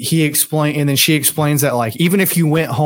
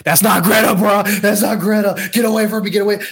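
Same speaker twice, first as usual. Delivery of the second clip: higher-pitched retold panic, half acted; mock panicked outburst; 5 semitones higher, faster than usual. Friend retelling the woman's scene, mock frightened and higher. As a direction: imitate her backing away in fright, voice raised.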